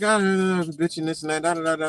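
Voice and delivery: high mock complaining in filler syllables; mock rambling rant; imitated bitching. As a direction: mimic someone griping on and on. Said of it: "He was just bitching".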